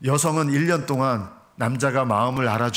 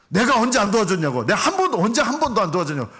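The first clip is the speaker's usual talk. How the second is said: higher, louder wronged protest; aggrieved husband; 7 semitones higher, 3 dB louder, faster than usual. Retold or imitated. Husband protesting to wife he always helped, aggrieved and loud. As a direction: imitate a husband feeling unfairly accused. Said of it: "억울한 거예요".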